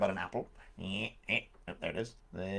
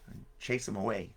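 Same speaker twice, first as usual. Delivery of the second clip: ordinary delivery, nothing marked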